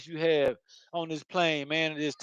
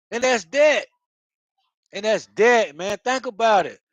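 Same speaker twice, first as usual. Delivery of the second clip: ordinary talk, nothing marked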